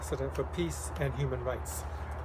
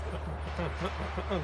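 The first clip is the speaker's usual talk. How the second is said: repeated hums; 3 semitones higher than usual. Shown as a demonstration. Singer counting out hums for the kids. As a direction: hum them out in a row. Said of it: "That was four times".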